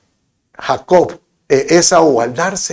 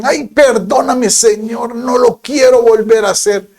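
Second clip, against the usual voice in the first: mock penitent; 8 semitones higher than usual. Sinner pleading to the Lord, hammed-up penitent whine. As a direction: beg forgiveness in a hammed-up whine. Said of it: "lo que tienen".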